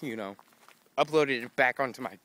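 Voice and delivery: ordinary talk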